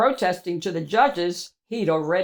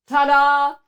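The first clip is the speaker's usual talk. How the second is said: high, loud flourish; triumphant ta-da; 6 semitones higher, 6 dB louder than usual. Acted. Proud cheese unveiling itself, triumphant. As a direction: present yourself with a fanfare.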